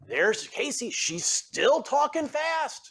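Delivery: high-pitched, hammed-up griping about an employee; put-on indignant boss, stretched and high; exasperated leader. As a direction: complain in a mock-exasperated high voice. Acts out an exasperated leader complaining.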